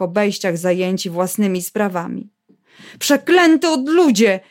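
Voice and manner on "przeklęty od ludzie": high-pitched curse. Acted. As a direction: voice rising higher in despair. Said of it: "Głos Stasi wchodził na wysokie tony". (przekleństwo, wyższym głosem)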